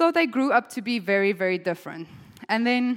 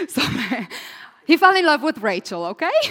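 laughing; 7 semitones higher, 6 dB louder, faster than usual. Real, not acted. Laughing at her own retelling.

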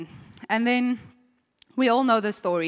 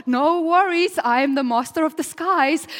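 higher, eager coaxing mother; scheming mom; 7 semitones higher, 6 dB louder than usual. Retold or imitated. Mother urging her son Jacob to steal the blessing, coaxing high voice. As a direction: coax your favourite son into the plan. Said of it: "Rebecca's like".

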